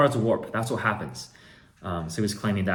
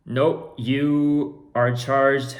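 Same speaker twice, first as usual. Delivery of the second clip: higher, louder and very slow nope; firm refusal; 6 semitones higher, 8 dB louder, much slower than usual. Retold or imitated. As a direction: drag out the nope firmly. Firm reply to scammer.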